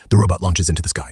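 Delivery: usual speaking voice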